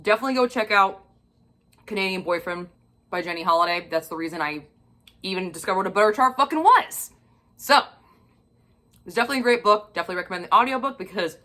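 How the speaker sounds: ordinary talk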